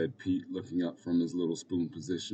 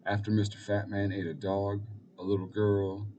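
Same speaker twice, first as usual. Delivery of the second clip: usual speaking voice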